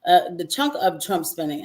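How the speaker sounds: ordinary talk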